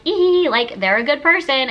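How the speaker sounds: high, loud mock gush over a new friend; gushing quote. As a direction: squeal it like a naive fan. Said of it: "I'm like".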